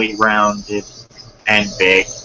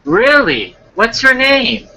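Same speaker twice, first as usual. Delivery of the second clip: put-on high-pitched girl character; put-on high girly questioning; girl's voice; 13 semitones higher than usual. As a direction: pitch it well up as a curious girl. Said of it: "then I'll do the girl's voice".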